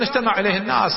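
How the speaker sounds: normal talking voice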